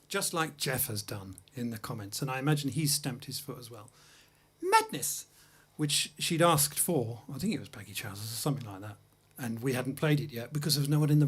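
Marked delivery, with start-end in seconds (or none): 4.6-5.3 mock bratty shout, much higher; imitating a demanding bride, high pitched yell; bride's demand. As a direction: shout it like a spoilt bride stamping her foot. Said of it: "and went".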